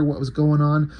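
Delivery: ordinary delivery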